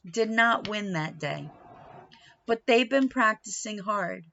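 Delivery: usual speaking voice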